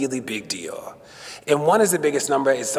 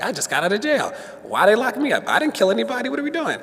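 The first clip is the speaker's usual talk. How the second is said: higher, incredulous inner rant; exasperated; 9 semitones higher than usual. Retold or imitated. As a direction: voice climbing in disbelief.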